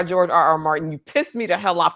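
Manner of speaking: normal talking voice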